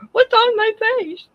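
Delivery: voicing the puzzled dog. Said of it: "He's like".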